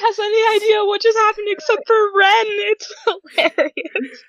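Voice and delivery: high-pitched talk through giggles; laughing with delight. Real, not acted. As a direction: barely get it out for laughing. Player amused by Karen leaving.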